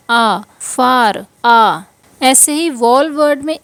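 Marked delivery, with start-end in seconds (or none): 0.1-1.8 आ drawn out long and open, then फार; long aa sound. Shown as a demonstration. Teacher drawing out the vowel for students. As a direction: stretch the आ like in far. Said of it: "इसे हम क्या पढ़ रहे हैं".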